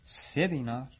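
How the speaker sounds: a touch of disbelief on سه دینار, voice slightly hushed; young worker balks at the three-dinar wage; shocked question. A narrator's reading. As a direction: repeat سه دینار as if stung by the offer. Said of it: "رنگ از رخسارش پرید و گفت".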